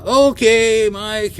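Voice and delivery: slow, high-pitched, drawn-out okay; retold taken-aback okay. Driver answers Mike's outburst, retold wary okay. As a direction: draw it out, backing off.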